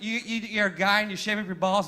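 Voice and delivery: ordinary delivery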